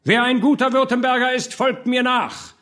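high, emphatic orator's voice; rally the crowd, building to a final cry; rallying speech. A narrator's reading. Hartmann leads burghers to open the gate, rallying speech.

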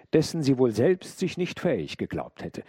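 plain reading voice

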